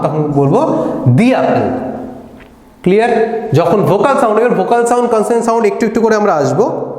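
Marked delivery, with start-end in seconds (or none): 1.1-1.7 "দি" with a long ee before "অ্যাপল"; "the" as "dee". Shown as a demonstration. Teacher showing the vowel-sound rule, "দি" said as "dee". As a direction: say "দি" as "dee", not "da". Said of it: "তখন কিন্তু আর দা বলবো না".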